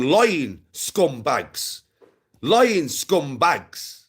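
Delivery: very slow, stretched-out accusation; deliberate, damning repetition; emphatic insult. Real, not acted. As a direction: drag out each word to drive it home. Condemning the accused liars, repeated.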